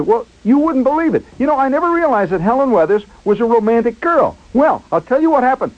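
high, eager confiding; excited storyteller. Retold or imitated. Schwartz confides about Helen Weathers, excited. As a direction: imitate a friend building up big news. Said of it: "he was all excited".

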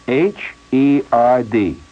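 each letter said slowly, one by one; slow spelling. Retold or imitated. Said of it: "spelling out letters".

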